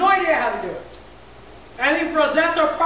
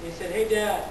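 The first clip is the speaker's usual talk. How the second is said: soft, low, much quieter storytelling voice; hushed story; 8 semitones lower, 6 dB quieter than usual. Acted. Pastor tells the church a touching story, hushed and low. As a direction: draw the congregation in with a quiet tale.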